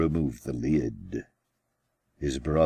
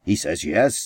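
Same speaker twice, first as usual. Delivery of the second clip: higher, louder call to the group; big brother announcing to all the boys, raised voice; louder announcement; 5 semitones higher, 8 dB louder than usual. A narrator's reading. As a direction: announce it proudly to everyone. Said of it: "announced in a louder voice to everyone".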